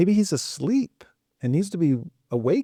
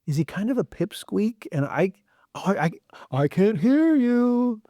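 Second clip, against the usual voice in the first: mock straining to hear; 8 semitones higher than usual. Elijah taunting the prophets, high mock complaint. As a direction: pretend you cannot hear a tiny voice. Mocking a god too faint.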